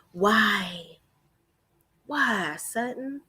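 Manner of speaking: drawn-out, softened despairing why; exasperated disbelief. Real, not acted. Vlogger pleading with Sutton after the insult, slow exasperated softness. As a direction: sigh the question out in disbelief.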